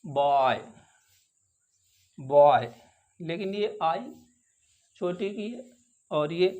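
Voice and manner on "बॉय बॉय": बॉय gliding o into i; oy sound. Shown as a demonstration. Teacher modelling the oy sound for the class. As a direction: glide the oy in बॉय. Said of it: "ये भी आई की आवाज़ है".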